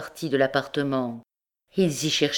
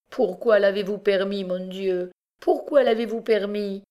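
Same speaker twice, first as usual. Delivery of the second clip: mother's anguished cry, higher pitched; crying out to God; 5 semitones higher than usual. A narrator's reading. Mother crying out to God in anguish. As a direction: cry out to heaven in despair. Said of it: "s'écria-t-elle".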